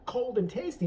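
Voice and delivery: ordinary talk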